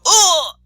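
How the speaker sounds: high, loud acted cry of pain; one long agonized "oh"; dying cry. Teacher imitates learner's last shock, loud high cry. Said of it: "you hear the guy go".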